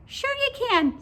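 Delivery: very high, squeaky little voice; high-pitched cartoon pigtail. A narrator's reading. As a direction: squeak it like a tiny talking pigtail. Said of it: "said the pigtail".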